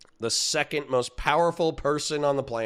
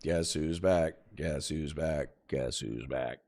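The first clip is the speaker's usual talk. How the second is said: each repeat lower and softer, dying away; fading echo; 8 semitones lower, 7 dB quieter, slower than usual. Pretend. Streamer's intro line echoing and fading away. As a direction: let it echo off into the distance.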